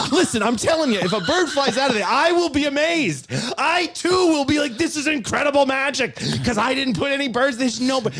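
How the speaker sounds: high-pitched, worked-up mock rant; exasperated. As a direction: argue it loudly, at wits' end. Exasperated argument with frightened volunteer.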